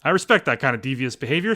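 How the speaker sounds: ordinary talk, nothing marked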